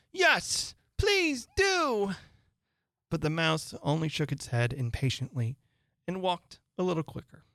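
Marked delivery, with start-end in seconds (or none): 0.1-2.2 very high, eager plea; joining in chorus. A narrator's reading. Others beg the Mouse to return, high chorus-like call. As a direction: chime in pleadingly. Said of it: "the others all joined in the chorus".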